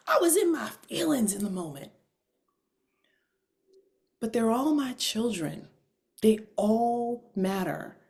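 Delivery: normal talking voice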